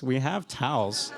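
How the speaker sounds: slow, patient repeat through a laugh; slow, amused second try. Retold or imitated.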